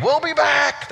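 high-pitched happy-camper call; kids yelling. Retold or imitated. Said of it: "the kids were yelling".

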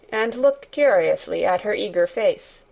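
plain reading voice

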